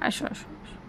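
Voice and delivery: breathy hushed call to a pet; calling the cat over in a whisper; whispered coaxing. Real, not acted.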